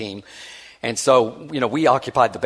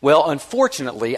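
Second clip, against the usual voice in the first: ordinary talk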